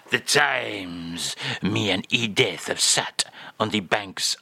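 low, lingering mock-French reminiscence. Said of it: "[name] is here".

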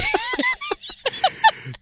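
a burst of high, breathless laughter; high squealing laugh. Real, not acted. Co-host cracking up at the radio joke, squealing laugh.

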